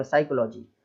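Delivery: 'साइकोलॉजी' begun on S alone; only 'साइकोलॉजी', no P sound; correct, silent P. Shown as a demonstration. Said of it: "केवल इतना ही बोलना". ('साइकोलॉजी' को केवल 'स' से शुरू करके)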